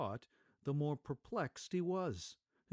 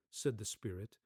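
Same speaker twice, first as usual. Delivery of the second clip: ordinary delivery, nothing marked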